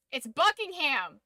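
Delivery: BUCKINGHAM said loud and high, insisting; insistent correction. Shown as a demonstration. Streamer correcting how viewers say it, insistent on Buckingham. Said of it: "it's not pronounced that way".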